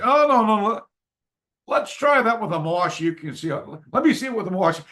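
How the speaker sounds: lightly voiced instructor; Bob correcting me. Bob teaching kata on train.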